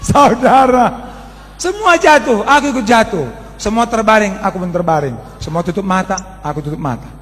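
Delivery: preaching at full force. Real, not acted. Animated testimony at full force.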